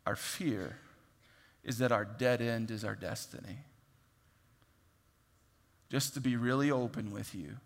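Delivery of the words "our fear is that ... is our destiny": ordinary talk, nothing marked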